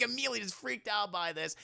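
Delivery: usual speaking voice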